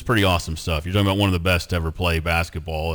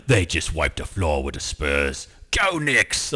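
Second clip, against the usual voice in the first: lower, slow tough-guy British voice; deadpan hard-man growl as the action star; Jason Statham impression; 6 semitones lower, 4 dB quieter, slower than usual. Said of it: "he's like".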